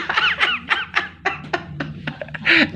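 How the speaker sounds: real laugh rising high and long; delighted high laughter. Real, not acted. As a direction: let a high laugh roll out. Laughing at Jonah story.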